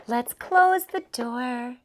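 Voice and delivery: normal talking voice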